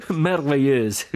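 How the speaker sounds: high laugh into a loud, drawn-out cry; laughing shout. A narrator's reading. Jack running through trees after the prank, laughing shout. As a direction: crow the word out slowly in hilarity. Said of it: "gridava Jack correndo".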